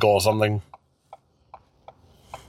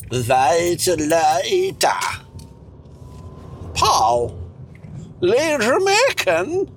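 mock accent; 7 semitones higher, much slower than usual.

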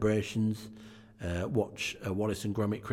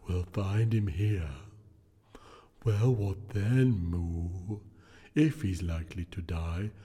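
unearthly voice; slower than usual. Acted. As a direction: intone it like a solemn spirit. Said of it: "returned the ghost".

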